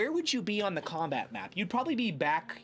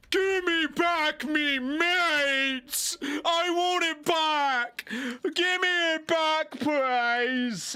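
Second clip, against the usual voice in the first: high, slow booming giant bellow; dopey cartoon bellow; 9 semitones higher, 5 dB louder, much slower than usual. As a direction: bellow it like a dim-witted hill giant. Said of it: "a booming voice from a creature go".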